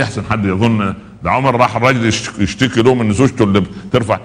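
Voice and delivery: ordinary delivery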